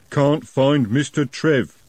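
slow plodding troll. A narrator's reading. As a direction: drag it out, dim troll. Troll speaks.

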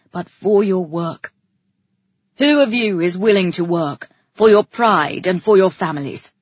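rousing speech. A narrator's reading. King rallies the women.

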